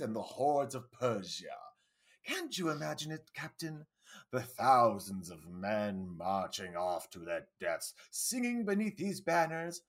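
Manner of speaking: vaguely posh, uncommitted British showman; half British accent. Acted. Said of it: "a half British accent".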